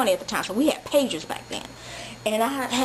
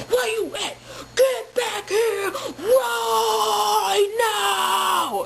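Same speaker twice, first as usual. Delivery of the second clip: much higher, drawn-out mock shout; frantic boyfriend yell; 11 semitones higher, much slower than usual. Boyfriend paging again, shouting high and drawn out. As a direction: stretch it into a crying holler. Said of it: "blowing up my pager".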